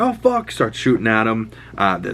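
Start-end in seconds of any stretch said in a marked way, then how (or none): 0.0-0.5 mock alarm. Ambusher's exclamation, retold.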